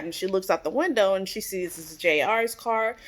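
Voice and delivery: normal talking voice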